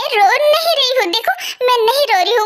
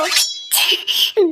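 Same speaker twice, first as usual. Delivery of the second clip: nervous laugh; 3 semitones lower than usual. Acted. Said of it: "हंसो मत".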